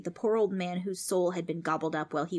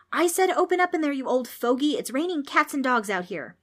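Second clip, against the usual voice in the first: loud, high hollering through a door; angry demand; 7 semitones higher, 5 dB louder than usual. A narrator's reading. Demons stuck in the rain, hollering angrily. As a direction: shout with mounting impatience. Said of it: "They were getting angrier".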